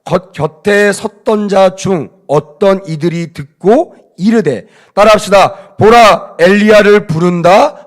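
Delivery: slow, loud reading of scripture. Shown as a demonstration. Preacher reading the verse to the church, loud and measured. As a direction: reads the verse aloud to the congregation, loud and slow. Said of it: "제가 읽어드리겠습니다".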